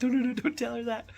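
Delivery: high, loud urgent pleading; dad hushing his son; hushed panicked dad. Retold or imitated. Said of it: "And I'm like".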